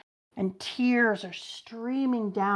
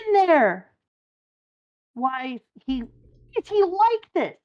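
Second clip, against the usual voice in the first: alarmed crewmate; 7 semitones higher, 5 dB louder than usual. Acted.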